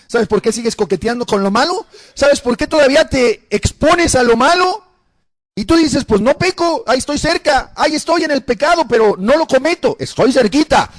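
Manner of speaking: high, insistent challenge to the congregation; preach. Real, not acted. (predicación insistente en tono alto)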